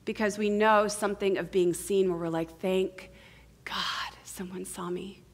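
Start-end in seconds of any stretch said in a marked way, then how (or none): 2.6-5.2 hushed, breath-out relief; comforted relief. Acted. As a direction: let it out softly, relieved to be seen. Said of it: "Our bodies immediately feel comfort".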